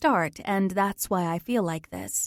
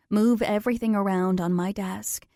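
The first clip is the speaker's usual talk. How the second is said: ordinary delivery, nothing marked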